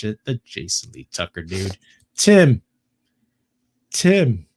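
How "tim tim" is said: loud, high shout of a name; calling out. Real, not acted. Host thanking chat viewers, calling out loudly. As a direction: holler it like a shout-out.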